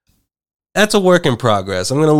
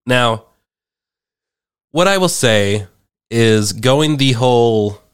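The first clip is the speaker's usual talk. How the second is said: usual speaking voice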